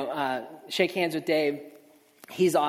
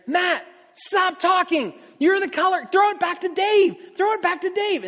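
frantic shouting; 14 semitones higher, 6 dB louder than usual. Retold or imitated. Director Jason yelling in his earpiece to hand back to Dave. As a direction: shout the orders in a panic.

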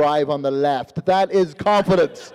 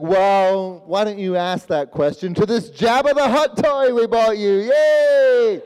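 put-on distract a child with forced excitement; mock cheer; 2 semitones higher, 4 dB louder, slower than usual. Mother deflects question with toy.

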